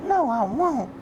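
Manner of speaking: high, loud falsetto "No, I won't"; lady refusing. Retold or imitated. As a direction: squeal the refusal like a prim lady.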